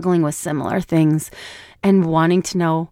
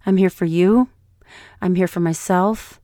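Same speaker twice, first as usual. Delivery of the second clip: ordinary talk, nothing marked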